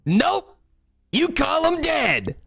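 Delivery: very high, drawn-out clown punchline; shrieky high villain taunt; sinister clown. Acted. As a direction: squeal the punchline with menace. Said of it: "you hear that voice again say".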